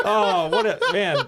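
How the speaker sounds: high laughing burst. Real, not acted. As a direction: laugh through the words. Laughing at his own mistake.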